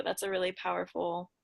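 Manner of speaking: ordinary delivery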